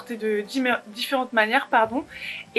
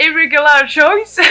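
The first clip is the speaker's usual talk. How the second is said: Irregular Choice slow and loud in a put-on English accent; 4 semitones higher, 9 dB louder, slower than usual. Shown as a demonstration. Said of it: "avec mon accent anglais".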